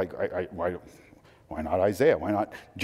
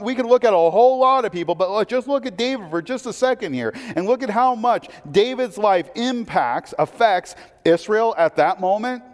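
higher, louder, driving sermon voice; fiery preaching; 9 semitones higher, 5 dB louder than usual. Acted. Pastor urging congregation about David's impact, fervent preaching. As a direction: preach it hard, building to the crowd.

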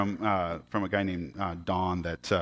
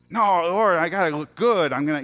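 louder, higher whining objection to God; mock protest; 7 semitones higher, 5 dB louder than usual. Logger arguing with God over the plain suit, mock protest. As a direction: blurt out a pleading objection, acted. Retold protest against God's order.

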